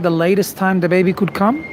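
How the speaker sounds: normal talking voice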